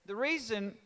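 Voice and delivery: normal talking voice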